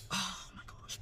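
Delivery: long breathy sigh into words; drained, whispered exasperation; exasperated sigh. Retold or imitated. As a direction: sigh out an unvoiced oh my gosh. Acting out exasperated waiting.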